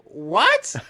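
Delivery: high, loud shocked exclamation; the stranger utterly baffled, a loud high shriek; baffled what. Retold or imitated. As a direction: blurt it out stunned, high and loud. Girl baffled.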